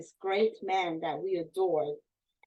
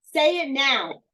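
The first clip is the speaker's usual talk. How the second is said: much higher and louder, the last words slow; screaming demand; 6 semitones higher, 9 dB louder, slower than usual. Retold or imitated. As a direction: yell the order at the kids. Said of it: "this man was screaming at us".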